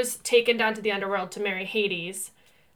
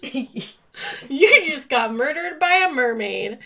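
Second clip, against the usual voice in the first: drawn-out announcer reading the ad; slow, hammed-up ad line, louder; mimicking a creepy video intro; slow dramatic ad voice; 4 semitones higher, 6 dB louder, much slower than usual. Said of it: "the video goes".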